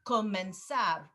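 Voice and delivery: each verb said slowly and clearly, with a pause for repeating; slow model drill. Shown as a demonstration. Teacher leading a repeat-after-me verb drill on Zoom. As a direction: read out each verb for the class to echo. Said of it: "I'm just gonna trust you to repeat these".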